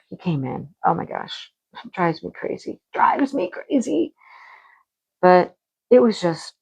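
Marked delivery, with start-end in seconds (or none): 2.9-4.1 exasperated. Real, not acted. Exasperated about the cat.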